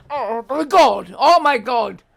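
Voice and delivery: loud, slow shocked exclamation. Real, not acted. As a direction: gasp it out in disbelief. Reacting to breakup text.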